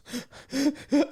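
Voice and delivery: sharp high gasp; imitated panicked gasp. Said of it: "he's crying".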